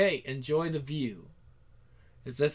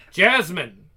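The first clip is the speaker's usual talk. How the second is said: loud, sharp call of her name; a father's loud summons; 3 semitones higher, 10 dB louder than usual. Acted. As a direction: yell her name to stop her. Father calls out, catches her.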